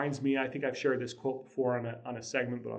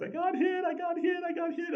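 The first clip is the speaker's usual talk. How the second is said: very high mock yell of a hurt little boy; high-pitched wail of a six-year-old running into the house; kid yelling. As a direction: shriek it like a kid who just got hit. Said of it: "he's just yelling at the top of his lungs".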